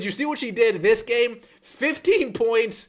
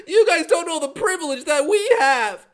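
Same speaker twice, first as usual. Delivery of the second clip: mock Becky screaming; 7 semitones higher than usual. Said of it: "Becky Hammon screaming".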